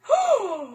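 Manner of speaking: high surprised intake of breath; a sudden high gasp; sharp gasp. Acted. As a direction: gasp in alarm. Children reacting in story.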